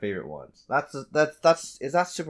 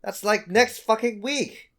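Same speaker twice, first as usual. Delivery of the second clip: excited realization; 7 semitones higher, 5 dB louder than usual. Real, not acted. Realizing release date is soon.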